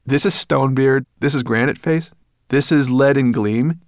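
put-on eager boy. A narrator's reading. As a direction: present each one proudly. Boy eagerly introduces the dwarves.

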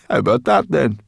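high, loud, delighted boast; smugly thrilled brownie; gloating. Acted. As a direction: crow over the compliment.

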